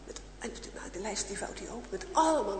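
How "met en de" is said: ordinary delivery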